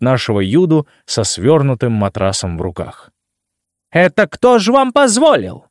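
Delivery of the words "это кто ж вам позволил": high, shrill shrieking hotel administrator; shrill screeching bird woman. Acted. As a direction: screech it like an outraged clerk. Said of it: "пронзительно заверещала администраторша".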